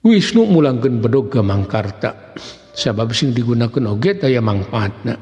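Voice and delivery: plain reading voice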